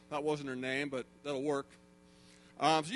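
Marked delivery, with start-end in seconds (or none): none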